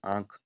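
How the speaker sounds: आंख with a soft nasalized आं; nasal vowel demo. Shown as a demonstration. Teacher showing the chandrabindu sound in आंख. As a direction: say आंख softly, letting the vowel go through the nose. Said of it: "जैसे आंख लिखते हैं हम लोग".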